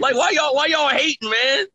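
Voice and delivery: excited. Retold or imitated. Mock heated fan rant.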